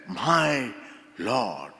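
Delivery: low, hushed and solemn; a quiet vow of faith, not a gasp; reverent confession. Shown as a demonstration. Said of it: "It's not a statement of surprise".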